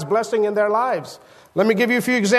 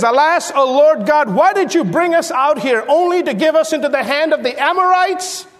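anguished lament; 6 semitones higher than usual. Acted. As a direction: raise the voice in a pleading lament. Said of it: "He says".

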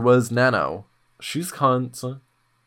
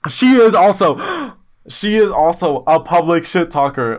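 dramatic gasp; 4 semitones higher, 7 dB louder than usual. Real, not acted.

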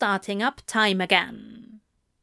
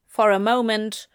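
ordinary delivery, nothing marked

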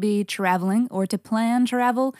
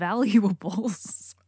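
valuables drawn out with a laugh; much slower than usual. Pretend. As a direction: laugh through valuables, slowly. Repeats word laughing.

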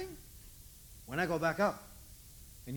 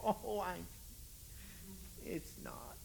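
ordinary talk, nothing marked

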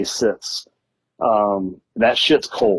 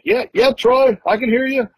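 high mock answer into the phone; imitating himself; 13 semitones higher than usual. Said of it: "And I'm like".

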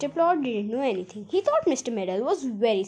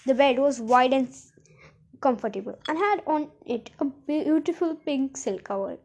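ordinary delivery

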